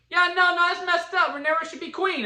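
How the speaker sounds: high, loud objection; indignant protest. Retold or imitated. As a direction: protest the injustice. The one dissenting lord.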